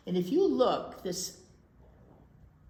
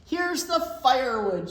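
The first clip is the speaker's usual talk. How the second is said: higher, loud call; shout; 6 semitones higher, 10 dB louder than usual. Acted. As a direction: shout it out proudly. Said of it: "shouted Jack".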